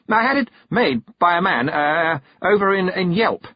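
high-pitched, halting, flustered; nervous stammer. A narrator's reading. Young noble explaining his gift, nervous and high. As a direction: stumble nervously through the explanation. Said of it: "going to explode with nervousness".

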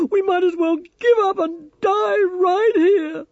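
loud, high hysterical wail; distraught, wailing outburst of giving up; sobbing despair. A narrator's reading. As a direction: pour it out in tears, cutting in. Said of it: "she sobbed".

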